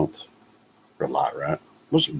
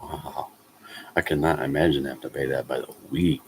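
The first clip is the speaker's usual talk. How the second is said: ordinary talk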